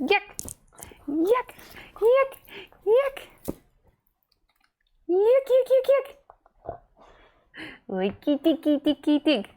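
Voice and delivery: very high squeaky playful chant; squeaky dog talk. Real, not acted. Owner playing with her excited dog, high squeaky chant. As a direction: chirp nonsense at the dog in a squeaky voice.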